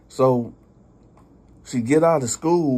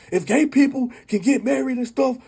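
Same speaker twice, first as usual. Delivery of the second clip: higher and louder, near yelling; getting loud; 12 semitones higher, 4 dB louder than usual. Retold or imitated. Uncle at her window demanding why not, high and loud. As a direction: push it further into a shout. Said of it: "Now he getting loud".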